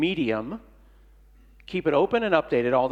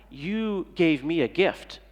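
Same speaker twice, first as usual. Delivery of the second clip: usual speaking voice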